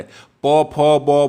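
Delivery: प, फ, ब said one by one with closing lips; lip sounds demo. Shown as a demonstration. Teacher repeats lip consonants to show the lips, clear. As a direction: pop each of प फ ब. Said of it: "होठ का उपयोग हो रहा है".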